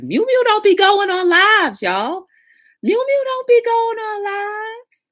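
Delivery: high, loud gushing; excited. Real, not acted. Gushing to viewers about Mew Mew's rare live, high and loud. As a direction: rave about it, high and thrilled.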